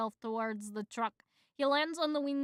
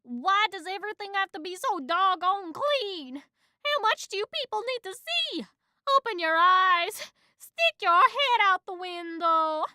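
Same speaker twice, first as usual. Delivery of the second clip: high, loud exasperated yell; loud, high ranting mosquito voice; yelling at driver; 8 semitones higher, 8 dB louder than usual. Acted. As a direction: rant through the glass. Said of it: "Moose blood yells at the driver".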